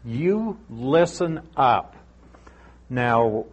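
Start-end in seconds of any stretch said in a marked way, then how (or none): 0.0-2.0 slow, each word of "You listen up" weighted; deliberate, emphatic command on "You listen up". Retold or imitated. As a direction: stretch out YOU and make it pointed. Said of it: "He's being emphatic".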